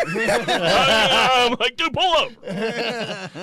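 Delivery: high, slow plea broken by laughter; laughing plea. Retold or imitated. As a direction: beg him to pull over while laughing.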